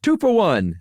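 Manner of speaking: stadium vendor hawking. Shown as a demonstration. Vendor call continued.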